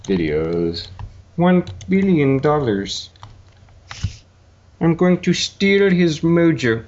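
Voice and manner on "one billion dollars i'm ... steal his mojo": slow, drawn-out villain delivery; Doctor Evil. Shown as a demonstration. Practising Doctor Evil lines for his cohost. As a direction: savour each word like Doctor Evil. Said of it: "Doctor Evil".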